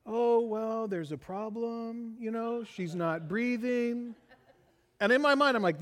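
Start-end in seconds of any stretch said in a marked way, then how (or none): 0.1-4.1 slow, soft, unbothered drawl mocking the doctor. Dad mocks the slow on-call doctor's unbothered drawl. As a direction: lazily imitate a doctor who won't hurry. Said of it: "and he's like".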